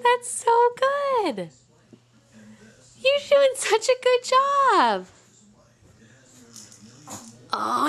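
put-on high, slow sing-song praise for a baby; drawn-out high-pitched motherese; baby talk. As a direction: coo it up high like talking to an infant.

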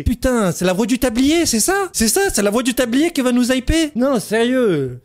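high, indignant questions slowing into disbelief; incredulous outrage. Real, not acted. Fan scoffing at who is meant to hype them, incredulous. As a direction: question it in disbelief, pitch leaping up.

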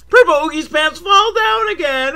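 hammed-up kids-show shout; mock kids-show excitement. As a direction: announce it like a gleeful host.

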